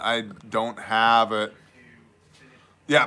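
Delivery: usual speaking voice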